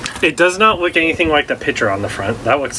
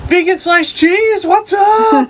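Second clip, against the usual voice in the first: much higher, slower, thrilled squeal; excited; 4 dB louder, slower than usual. Retold or imitated. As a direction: squeal it like a delighted find. Retelling spotting the cheese.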